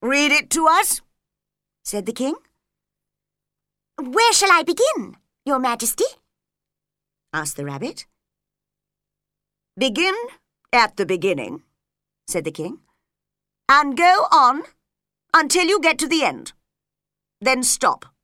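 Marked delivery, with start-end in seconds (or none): 0.0-1.0 king ordering. Acted. 4.0-6.1 high, louder eager question. Acted. Rabbit asks king. 9.8-18.1 louder, brisk royal orders; commanding king. Acted. King instructs Rabbit.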